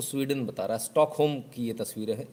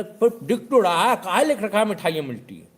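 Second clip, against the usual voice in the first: much higher, louder scornful scolding; mock shout; 8 semitones higher, 8 dB louder than usual. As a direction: yell the obvious at him. Customer scolding shopkeeper.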